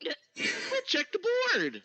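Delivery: slow, high, laughing; laughing dwarf voice. Acted. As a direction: laugh through the words.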